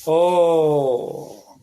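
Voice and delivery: ordinary talk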